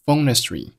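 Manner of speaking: "phone history" run together without h; linked words. Shown as a demonstration. Teacher showing learners linking, "phone history" with no h. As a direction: carry the n of "phone" into "history", h dropped. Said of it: "history开头的h给击穿".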